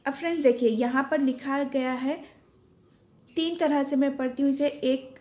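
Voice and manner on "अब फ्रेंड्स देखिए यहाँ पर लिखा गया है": ordinary talk, nothing marked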